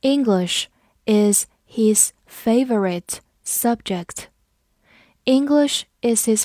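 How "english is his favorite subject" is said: slow and half-whispered, word by word; slow whispered model reading. Shown as a demonstration. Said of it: "我们慢读一遍".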